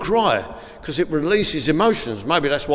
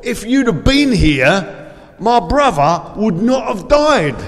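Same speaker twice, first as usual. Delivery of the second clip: raised, slow, emphatic retold plea; Mary's appeal; 6 semitones higher, 4 dB louder, slower than usual. Mary appeals to Jesus at the tomb, raised retold plea. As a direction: voice Mary's appeal, higher and drawn out. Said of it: "and she said".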